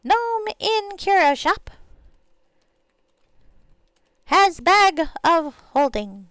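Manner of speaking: slow, drawn-out note-taking; writing aloud. Real, not acted. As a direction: dictate word by word as you write. Dictates a note while writing it.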